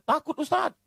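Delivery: put-on high childlike reply; children answering. Classmates telling the preacher they are scared, high childlike voice. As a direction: answer like small scared kids.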